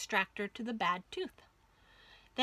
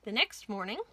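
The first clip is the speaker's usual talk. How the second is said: usual speaking voice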